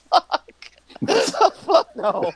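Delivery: high, laughing refusal; jovial refusal. Acted. Turning down the party's loyalty oath, jovial and laughing. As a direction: refuse while cracking up. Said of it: "like, a jovial way".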